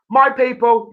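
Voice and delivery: usual speaking voice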